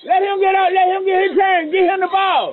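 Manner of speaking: put-on high-pitched yell across the room at the kids; hollering at rowdy kids to stop fighting; calling out. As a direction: shout over the noise to stop the kids fighting. Directing kids at trampoline park.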